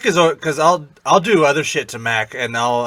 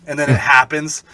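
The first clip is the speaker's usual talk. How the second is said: normal talking voice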